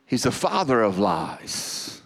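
ordinary talk

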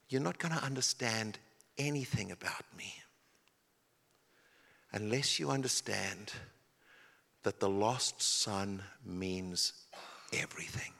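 soft, low whispered appeal; Jesus whispering to the congregation; hushed voice of Jesus. Acted. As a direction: lean in and breathe the words. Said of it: "And he says to the good people".

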